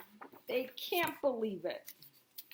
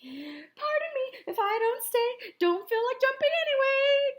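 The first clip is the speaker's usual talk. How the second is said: Goldilocks voice; 13 semitones higher, 5 dB louder than usual. A narrator's reading. Goldilocks excusing herself to the bears, very high. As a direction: say it like a flustered little girl leaving.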